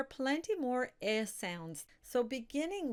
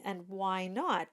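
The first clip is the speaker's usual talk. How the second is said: ordinary talk, nothing marked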